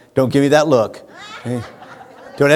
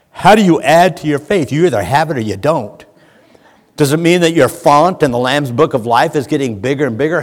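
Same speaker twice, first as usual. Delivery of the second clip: ordinary talk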